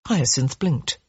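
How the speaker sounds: ordinary delivery, nothing marked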